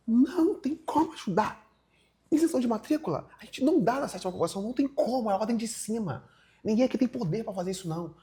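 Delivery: high-pitched imitation of a hollering clerk; mock yelling. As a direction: refuse emphatically, pitched well up. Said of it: "ela tá berrando".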